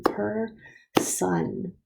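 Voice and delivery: "Per-son" in two separate beats with a pause; split into syllables. Shown as a demonstration. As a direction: clap out "Per-son", each syllable held apart. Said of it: "clap it out again".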